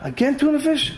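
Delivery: boil over at the same lunch again; angry outburst. Retold or imitated. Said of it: "He got so angry".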